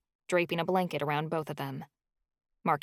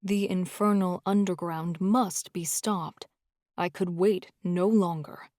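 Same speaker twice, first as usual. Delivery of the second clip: low grave man. Acted. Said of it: "A man's voice was low as he uttered".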